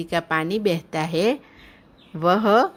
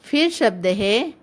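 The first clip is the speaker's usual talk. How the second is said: usual speaking voice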